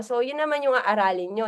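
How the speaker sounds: ordinary talk, nothing marked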